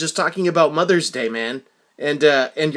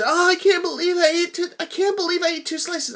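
high, fussy imitation of a dainty eater; mimicking mother's voice; 14 semitones higher than usual. Son imitating his mother fretting over two slices, high voice. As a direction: pitch way up to play a small woman fretting over pizza.

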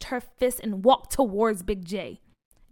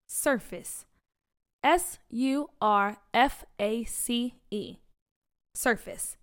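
careful spelling; slower than usual. Acted. Spelling bee contestant spells word.

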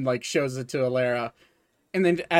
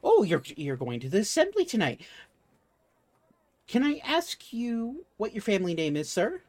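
rising higher into a sweet, prying question; eager shopkeeper; 8 semitones higher than usual. Acted. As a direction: coax the name out of the customer. GM voices the shopkeeper warforged.

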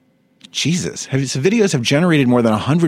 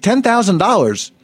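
lightly voiced quote; 8 semitones higher than usual. Porn star repeats flatly.

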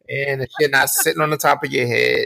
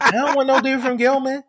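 much higher-pitched girl impression; mock girl; 11 semitones higher than usual. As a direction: put on high voice, dismissing the boys. Girls' reaction to school, acted.

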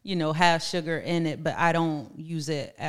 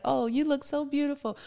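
high, bright gushing compliments; gushing praise; 6 semitones higher than usual. Retold or imitated. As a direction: gush over them like a proud friend. Voicing her own praise of others.

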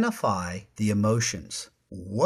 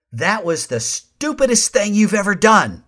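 loud, higher, accusing outburst; reactive snap; 7 semitones higher, 7 dB louder than usual. Shown as a demonstration. Host demonstrating a reaction for listeners, loud and accusing. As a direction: blurt it out as an angry reaction. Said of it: "there was a difference in the way I said them".

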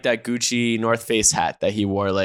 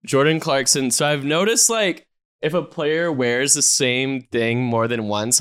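normal talking voice

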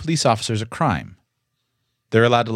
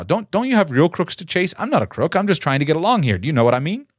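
nervous babbling; 2 semitones higher than usual. Shown as a demonstration. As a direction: babble on anxiously, words tripping over each other. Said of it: "here's an example of babbling".

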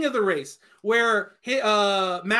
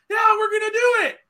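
mock shout; 9 semitones higher, faster than usual. Mocking the race director's sudden decision.

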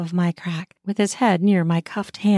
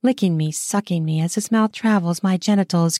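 plain reading voice

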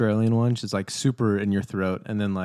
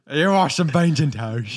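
mock British accent; 3 semitones higher, 3 dB louder than usual. Co-host joining in on British accents, mock British accent. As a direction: join in with a hammed-up British accent. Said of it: "There's some British accents that are like".

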